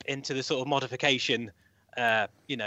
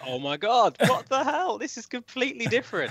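shocked disbelief; 8 semitones higher than usual. Retold or imitated. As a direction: react like you can't believe it. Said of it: "I was like".

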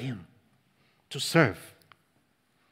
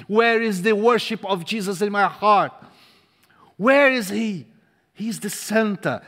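rising, louder preacher's call, hammering each question; preaching at full force; 6 semitones higher, 6 dB louder than usual. Real, not acted. Preacher presses the church with questions, at full force. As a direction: drive the questions home to the congregation.

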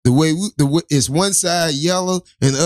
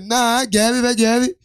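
imitating driver; 6 semitones higher than usual. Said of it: "She's like".

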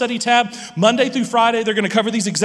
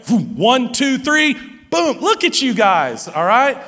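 ordinary talk

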